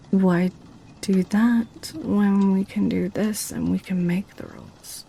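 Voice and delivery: normal talking voice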